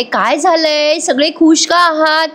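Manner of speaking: curious surprise. A narrator's reading. Asmita entering, asking why everyone is happy, with curious surprise. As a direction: wonder what the fuss is about.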